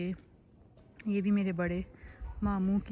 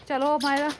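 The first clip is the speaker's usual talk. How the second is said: calling out; 7 semitones higher, 8 dB louder than usual. Real, not acted. Calling Myra to come along to the aunt's house, calling out. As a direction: call her along, pitched up and loud.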